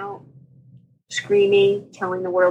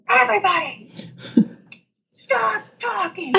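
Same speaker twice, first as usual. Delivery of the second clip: high-pitched retold shout, slowing on the order; mock yell; 12 semitones higher than usual. Doctor clearing a noisy operating room, mock yell. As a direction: imitate calling a whole room to silence.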